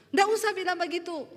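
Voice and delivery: mock an impatient collector; debt collector's retort.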